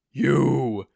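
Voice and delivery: loud, booming single word; booming giant's shout; booming accusation. A narrator's reading. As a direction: bellow it across the field. Giant dramatically points.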